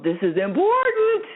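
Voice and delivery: high-pitched, overblown insistence; hammed-up alarm, pitch jumping way up; mock urgency. Host mimicking the energy of labeling things important, mock urgency.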